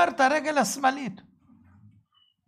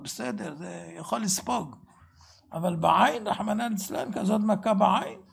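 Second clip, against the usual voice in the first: ordinary talk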